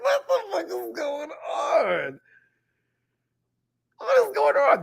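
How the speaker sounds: high and slow, half-wailing what is going on; mock-crying disbelief. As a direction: wail it like a despairing fan. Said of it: "I'm watching like".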